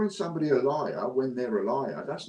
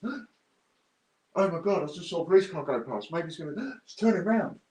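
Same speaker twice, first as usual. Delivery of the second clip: mock panic; 6 semitones higher than usual. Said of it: "As soon as they see a police car go past".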